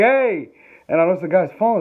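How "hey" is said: a louder, higher "Hey!"; retold call. Stranger shouts after the fleeing couple, louder call. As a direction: shout after them as they hurry off. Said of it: "It's like".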